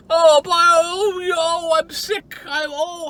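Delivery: high, slow, fake sick moaning; mock crying. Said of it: "hypochondriac bullshit".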